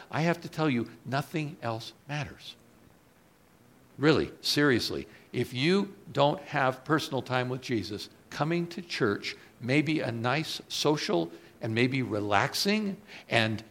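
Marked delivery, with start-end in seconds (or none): none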